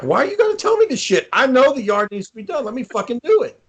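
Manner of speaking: hammed-up frustration at being nagged; mock exasperated rant. His exasperated reply acted out.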